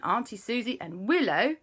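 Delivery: ordinary delivery, nothing marked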